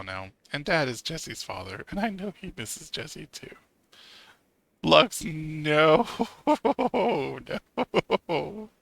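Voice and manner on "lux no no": loud, high laughing no; laughing shout. Real, not acted. Streamer shouting no at her pet Lux, laughing. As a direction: yell at the cat through laughter.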